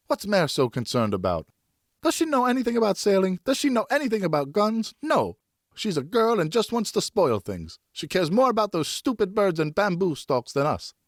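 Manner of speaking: put-on high, indignant sulky boy; sulky son lashing out at his sister; boy's outburst. A narrator's reading. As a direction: whine it out like a jealous son.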